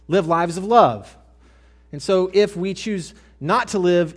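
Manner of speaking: loud, high, emphatic preaching; preaching at full force. Acted. Preacher urging the congregation about God's love, loud and high. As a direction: drive it home to the congregation.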